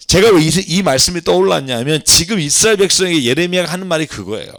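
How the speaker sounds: usual speaking voice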